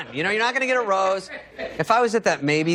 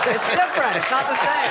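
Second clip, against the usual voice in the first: higher, mocking repeat; 9 semitones higher than usual. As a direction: parrot it back in a high voice. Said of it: "He's like".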